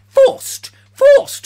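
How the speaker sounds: word jumps very high and loud; comic high squeal on forced; squeaky yelp. Real, not acted. Sudden squeak mid-sentence.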